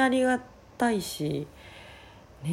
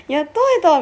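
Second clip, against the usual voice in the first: excited; 10 semitones higher, 9 dB louder, faster than usual. Retold or imitated. Retold happy reunion cry.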